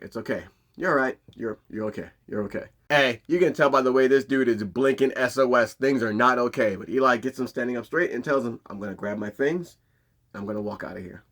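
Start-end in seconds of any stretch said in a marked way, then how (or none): 0.0-2.6 quietly soothing a frightened man; calm him down gently; soft reassuring. Retold or imitated. Eli calming the scared dude. 8.7-11.2 soft, steady warning; quiet calm. Retold or imitated. Eli calmly stating his exit.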